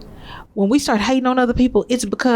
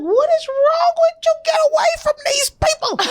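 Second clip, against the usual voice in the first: retold alarm. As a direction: act out a mother's panicked scolding, pitched way up. Retold alarm at son approaching strangers.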